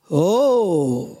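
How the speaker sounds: a low man's grumbling oh; lightly voiced deep stranger's grumble; deep male grumble. A narrator's reading. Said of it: "brummte eine tiefe Männerstimme".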